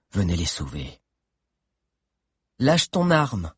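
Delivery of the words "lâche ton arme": commanding voice. A narrator's reading. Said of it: "ordonna une voix".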